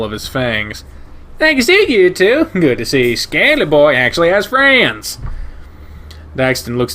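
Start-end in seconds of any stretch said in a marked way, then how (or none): 1.4-5.2 high opening, loud close; a cheerful big dog sizing you up; brash shepherd voice. Acted. Ryan's parting jab.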